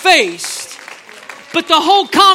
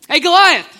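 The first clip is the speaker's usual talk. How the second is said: loud, confident hail; bold call-out; 3 semitones higher, 7 dB louder than usual. Retold or imitated. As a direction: call the giant out boldly. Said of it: "Probably didn't say it like that".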